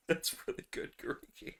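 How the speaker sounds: breathless whispered praise. Real, not acted. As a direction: whisper it through laughter. Whispered aside while laughing.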